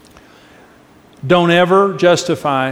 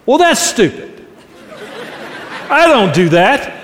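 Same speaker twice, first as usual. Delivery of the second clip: dismissive retort; 5 semitones higher than usual. Retold or imitated. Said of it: "Just like that".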